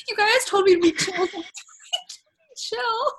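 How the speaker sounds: imitating a tearful, high-pitched protest; mock sobbing. Co-host imitates a sobbing cast member from the preview, mock sobbing. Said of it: "she's, like, sobbing".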